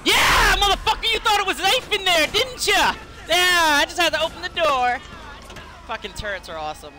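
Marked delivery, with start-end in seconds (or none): 0.0-5.0 gloating shout. Real, not acted. Taunting the enemy he just killed, high gloating shout. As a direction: crow over the kill, mocking the victim.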